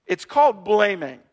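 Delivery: ordinary talk, nothing marked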